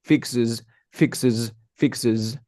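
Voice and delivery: "fixes" ending in a buzzed z; drilled "fixes" with a humming ending; vibrating final Z. Shown as a demonstration. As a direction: repeat "fixes", voicing the last s as z. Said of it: "tengo que hacer este vibración de z".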